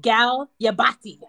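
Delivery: loud Jamaican mother voice; a hint of a Jamaican mom. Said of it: "if my mom goes".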